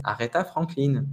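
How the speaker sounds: "Aretha Franklin" as "a-ré-ta fran-kline"; French pronunciation. Shown as a demonstration. French person without much English naming her, French pronunciation. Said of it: "va prononcer ça à la française".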